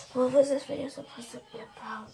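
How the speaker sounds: breathy whispered aside; whispering. Real, not acted. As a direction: whisper the question to yourself. Musing aside to herself.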